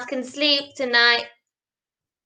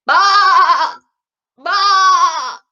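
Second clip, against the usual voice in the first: loud sheep baa; bleating; 5 semitones higher, 5 dB louder than usual. Acted. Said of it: "And Sheep went".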